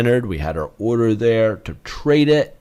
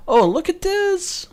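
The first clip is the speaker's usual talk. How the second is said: surprised. Real, not acted. Surprised at chart.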